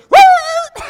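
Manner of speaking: very high, loud startled yelp; sudden piercing howl; dog yelp. Retold or imitated. Shocked dog yelps.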